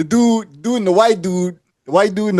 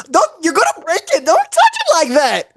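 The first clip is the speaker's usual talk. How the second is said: put-on high fussy girlfriend; 11 semitones higher than usual.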